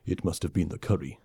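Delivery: soft, deadpan; lightly voiced alien, dry shrug. A hint of the alien warrior dismissing it, soft deadpan. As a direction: shrug it off dryly. Suruk resigned.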